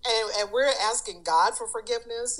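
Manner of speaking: ordinary talk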